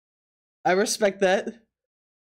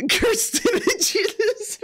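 high, very slow, laughing it out; 13 semitones higher, much slower than usual. Real, not acted. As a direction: draw out the words through a laugh. Cracking up over a drawing.

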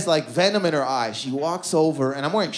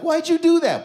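forced cool; 13 semitones higher, 4 dB louder than usual. Retold or imitated. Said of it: "play it cool".

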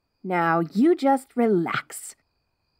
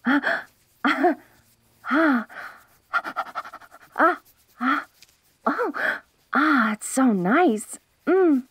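blissful sigh; 3 semitones higher, much slower than usual. Real, not acted. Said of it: "could you scratch my hair".

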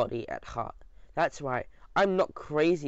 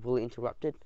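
usual speaking voice

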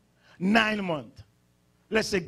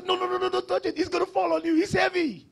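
high-pitched, hurried adult fussing; mock parental warning; 10 semitones higher than usual. Parent stopping a child lifting something heavy, high mock warning. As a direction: lightly act out warning a child off.

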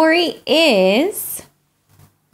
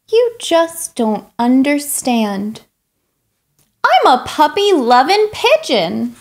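lightly voiced pushy pigeon, pleading and dramatic; a hint of a whiny, excitable bird; pigeon character; 3 semitones higher, 3 dB louder than usual. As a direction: play the eager pigeon begging for a puppy. Reader voices the pigeon throughout.

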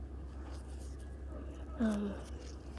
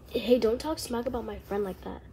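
ordinary delivery

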